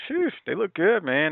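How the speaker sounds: impressed. Retold or imitated. Retold reaction watching promo video.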